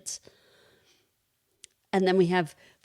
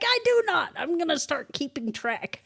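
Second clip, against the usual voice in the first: hammed-up offended wife; mock indignant protest; 10 semitones higher than usual. Protesting her husband's teasing.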